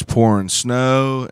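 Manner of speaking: ordinary talk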